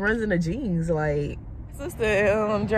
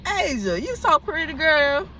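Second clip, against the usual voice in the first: excited cooing; 10 semitones higher, 4 dB louder, slower than usual. Real, not acted. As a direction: call her name in pure delight. Calling baby by name.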